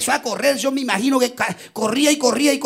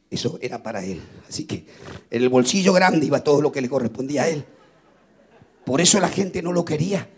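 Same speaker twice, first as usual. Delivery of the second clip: tender sermon narration; 9 semitones lower than usual. Acted. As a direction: draw them into the moment gently. Tender turn to Zacchaeus weeping.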